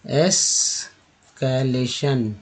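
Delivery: Escalation stretched slowly, शन clear; slow syllable demo. Shown as a demonstration. Teacher slowly modelling escalation. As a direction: draw out escalation for learners. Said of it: "टी एन को बोलते हैं सन".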